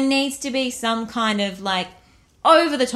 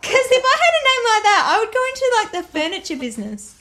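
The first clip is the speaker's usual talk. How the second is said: high, loud giggly riffing; laughing incredulity; 11 semitones higher, 6 dB louder, faster than usual. Real, not acted. Co-host jokes about husband's silly name, laughing. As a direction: riff through laughter, pitch way up.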